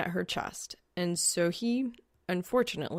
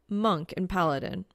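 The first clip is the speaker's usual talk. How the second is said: ordinary delivery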